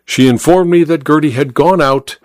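usual speaking voice

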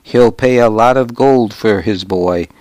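plain reading voice

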